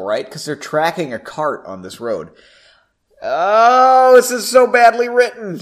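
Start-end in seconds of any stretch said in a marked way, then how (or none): 3.2-5.6 exasperated outburst. Real, not acted.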